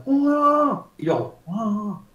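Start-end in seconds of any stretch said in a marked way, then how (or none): 0.1-0.9 high, drawn-out wail of emotion; client's acted "uah". Client venting emotion to the coach, high exclamation. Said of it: "Él hace". 1.5-2.0 softer, drawn-out echo of the exclamation; mirrored "uah". Acted. Coach matching the client's emotion, soft "uah". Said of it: "Estoy igualando la emoción".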